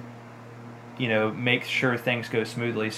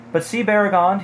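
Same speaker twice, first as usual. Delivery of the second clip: higher-pitched call to a companion; crying out; 8 semitones higher, 4 dB louder than usual. A narrator's reading. Pippin points out the riders to Baragond, crying out. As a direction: call out as the character, mildly urgent. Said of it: "he cried".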